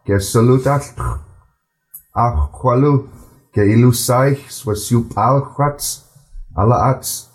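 unhurried storytelling read-aloud; slow careful reading. Shown as a demonstration. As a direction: read it slowly in a gentle even flow for learners to follow. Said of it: "I'm gonna read it a bit slower".

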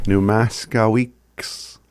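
"New mask a weeks" said slowly and deliberately, syllable by syllable; unhurried pronunciation demo; slow model phrase. Shown as a demonstration. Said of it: "repeats after the recording".

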